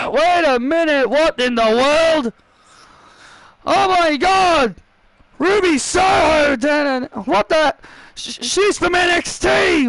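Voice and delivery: excited commentator. Acted. Commentator announces an NXT wrestler interrupting the champ, astonished. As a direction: call it like a shock debut. Commentator reacts to Ruby's entrance.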